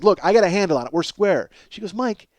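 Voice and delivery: usual speaking voice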